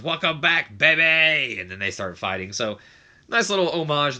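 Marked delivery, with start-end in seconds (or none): 0.0-1.6 higher, louder mock wrestler shout of welcome back; imitating Slater's yell. Slater greeting the chanting crowd, hammed-up higher yell. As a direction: belt it out like a wrestler hyping the crowd. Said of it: "Slater goes".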